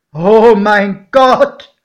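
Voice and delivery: loud, high, drawn-out exclamation; horrified call. A narrator's reading. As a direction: cry out slowly in the godfather's horror. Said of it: "rief mein Pate". (entsetzter, lang gezogener Ausruf)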